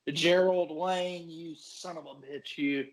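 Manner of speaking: mocking outrage at owner. Mock addressing team owner.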